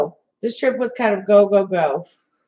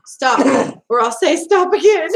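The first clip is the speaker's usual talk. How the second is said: laughing imitation of an Irish accent, pitched up and loud; mock Irish accent; 4 semitones higher, 5 dB louder, slower than usual. Quoting his favorite part of the trip, mock Irish accent. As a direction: put on a playful Irish accent through laughter. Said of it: "his Irish accent".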